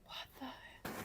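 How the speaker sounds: breathy stunned whisper; whispered disbelief. Real, not acted. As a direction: hush it, baffled. Stunned at the broken site.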